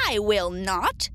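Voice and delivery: slow, defiant witch refusal; retorting witch. A narrator's reading. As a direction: refuse slowly, as the wicked witch. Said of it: "retorted the witch".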